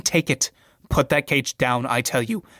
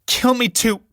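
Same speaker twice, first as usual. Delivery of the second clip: screaming; 8 semitones higher than usual. Acted. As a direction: shriek it at him. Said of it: "Julie, screaming".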